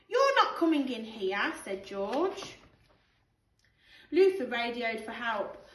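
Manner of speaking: put-on lively storybook read-aloud for children; animated picture-book narration with character voices; bedtime story reading. Teacher reads bedtime story aloud.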